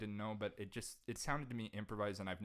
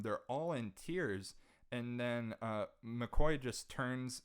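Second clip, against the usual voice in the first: usual speaking voice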